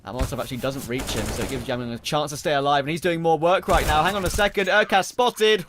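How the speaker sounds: rising to a high, loud call; play-by-play. Real, not acted. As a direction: build the hype as the play unfolds. Live play-by-play casting.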